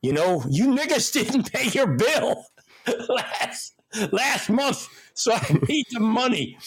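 high, chuckling reminder of the unpaid bill; collect the money, laughing through it in a high voice; Chappelle's milkman character. Retold or imitated. Milkman tells the family about the unpaid bill, high and laughing. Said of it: "he turns to the family and he goes".

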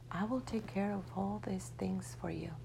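softly whispered reassurance; tender whisper. Acted. Great Mother reassures Juan Diego in a tender whisper. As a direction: whisper it to soothe him.

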